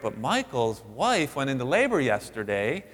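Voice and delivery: usual speaking voice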